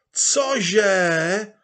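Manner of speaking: high, loud incredulous shout; crowd roaring. Acted. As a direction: roar it in disbelief. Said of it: "znovu zahučela masa". (dav nevěřícně zahučí)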